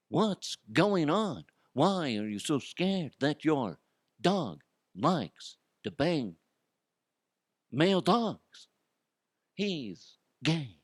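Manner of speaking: slow, drawn-out silly voice; goofy put-on voice. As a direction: stretch the words out in a goofy put-on voice. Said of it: "I like to talk like this".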